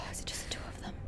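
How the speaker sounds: breathless frightened whisper. Real, not acted. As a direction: whisper while hiding. Hiding in fear.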